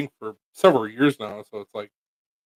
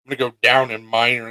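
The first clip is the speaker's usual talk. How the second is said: normal talking voice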